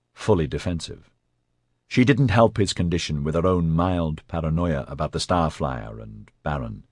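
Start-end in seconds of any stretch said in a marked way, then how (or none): none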